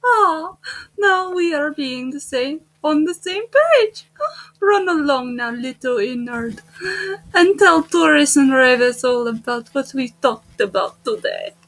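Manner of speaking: put-on smug Russian-accented boss. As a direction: send him off in a drawn Russian accent. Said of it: "I'm doing the Russian voice".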